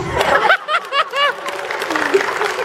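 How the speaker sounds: high burst of laughter; loud laugh. Real, not acted. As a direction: crack up after the yell. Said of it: "I'm sorry if that's too much".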